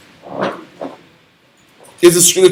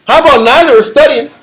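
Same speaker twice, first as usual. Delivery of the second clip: ordinary talk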